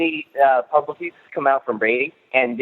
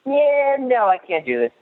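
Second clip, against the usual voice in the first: high-pitched retold refusal, drawn out at first; mock quitting; 10 semitones higher, slower than usual. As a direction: imitate a runner giving up, pitched way up. Retold quote of runner quitting.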